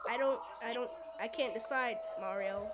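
ordinary delivery

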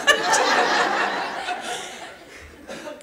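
long, high, breathy burst of laughter; real laughter. Real, not acted. Laughing after her father teases her about repeating herself, helpless laughter. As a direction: break into helpless delighted laughter.

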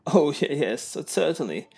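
high, flustered posh gentleman. Acted. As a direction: stammer politely like a posh guest. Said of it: "same damn posh voice".

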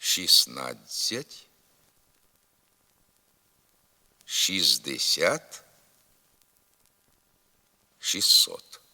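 slow model of Шістнадцять, шістдесят, шістсот without the first т; silent т. Shown as a demonstration. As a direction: drill шіснадцять, шіздесят, шіссот for repeating, with long pauses. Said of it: "Повторіть за мною".

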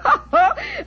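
a high, bright burst of laughter; sudden high laugh; delighted laugh. Acted. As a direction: laugh it off, caught out and amused. Laughs at being recognized.

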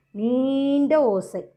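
நீண்ட stretched very slowly; teacher lengthening நீண்ட to show length; drawn-out long sound. Shown as a demonstration. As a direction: hold the first vowel of நீண்ட. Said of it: "அதிக சத்தத்தோடு உச்சரிக்கணும்".

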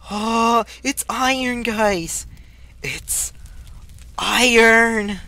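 high gasp and loud cry; gasp, then announce the iron to the guys; high, loud, amazed delight; thrilled gasp. Real, not acted.

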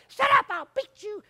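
a hint of a high, loud scolding mom; mock snap of an angry mother; mother's threat. Preacher imitating a mother scolding her son, mock angry. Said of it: "And she's like".

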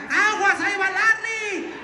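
high warning shout, drawn out; yelling across the room. Real, not acted. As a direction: call out a warning from far off.

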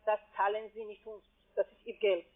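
usual speaking voice

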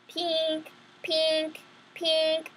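high sing-song alarm ring; alarm sound. Acted. Went my alarm.

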